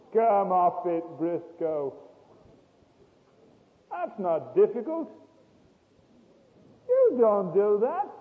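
loud, high scolding from the inner voice. Retold or imitated. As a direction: rebuke yourself as the voice of conscience, loud. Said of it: "a little voice inside began to say".

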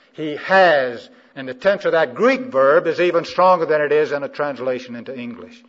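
loud, high, insistent questioning; forceful preaching. Real, not acted. Preacher pressing the congregation for the verb's tense, forceful preaching.

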